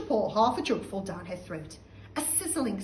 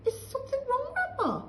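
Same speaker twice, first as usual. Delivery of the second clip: much higher, mock polite; polite boy. George asking stunned grandma, mock polite. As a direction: ask sweetly, as if innocent. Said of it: "George asked her politely".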